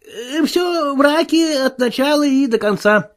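high-pitched, drawn-out insistence; insistent denial. A narrator's reading. Marvel declaring it all lies, insistent. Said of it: "решительно заявил Марвел".

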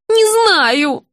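high, upset denial; confused old woman. A narrator's reading. Patient unable to name the father, upset. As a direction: blurt it in a high childlike voice.

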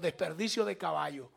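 ordinary delivery

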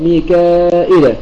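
slow, drawn-out "ميكائيل" with the hamza kept and no second ya; ميكائيل بإثبات الهمزة وحذف الياء; Nafi's reading. Shown as a demonstration. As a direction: say "ميكائيل" slowly, keep the hamza, drop the ya. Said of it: "فإذا نافع يقرأ بإثبات الهمزة وحذف الياء".

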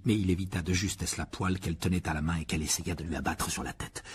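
plain reading voice

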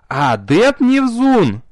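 high, slow shouted name; straining shout. A narrator's reading. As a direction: yell the name at full strain. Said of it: "надрывалась маркиза Даракаш".

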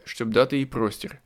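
plain reading voice

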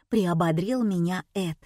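ordinary delivery, nothing marked

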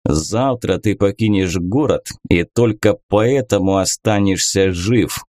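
usual speaking voice

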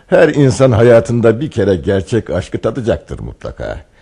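ordinary talk, nothing marked

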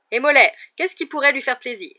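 high, loud solicitous question; loud, eager-to-please uncle; jovial host. A narrator's reading. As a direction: fuss heartily over the guest's pet. Host fussing over the guest's dog.

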